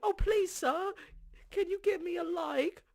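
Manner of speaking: high, whiny begging voice; pleading beggar. Acted. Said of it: "Do it with your beggar".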